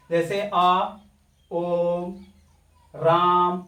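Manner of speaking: ओ and राम held for three beats; drawn-out vowels. Shown as a demonstration. Teacher demonstrating pluta vowels to students, long held vowels. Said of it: "ज्यादा समय लग रहा है".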